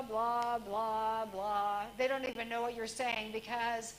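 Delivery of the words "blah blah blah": droning blah. Shown as a demonstration. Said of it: "is this".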